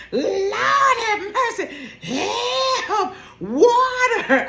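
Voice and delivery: high laughing cries for help; mock drowning cries. [name] pretending to drown, hammed-up high cries for help. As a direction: ham up a drowning woman, high. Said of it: "you gonna hear [name] drowning".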